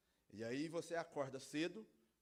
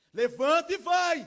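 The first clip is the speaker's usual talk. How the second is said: very loud, high wake-up order; father bellowing a command; harsh parental shout; 22 dB louder than usual. Acted. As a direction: yell it at a child still in bed. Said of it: "Não fala assim com o seu filho".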